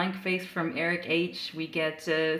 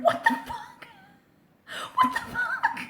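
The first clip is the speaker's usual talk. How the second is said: high squealing laugh through the words; helpless, very high-pitched laughter; laughing in disbelief. Real, not acted. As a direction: barely get it out for laughing. Cracking up at absurd comic line.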